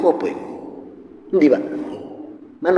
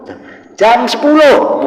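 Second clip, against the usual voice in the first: higher, louder and slow, calling out the hour again; shouting to deaf father; 9 semitones higher, 8 dB louder, slower than usual. Retold or imitated. Son repeats the time loudly to deaf father, shouting. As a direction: shout it slow so the deaf old man hears.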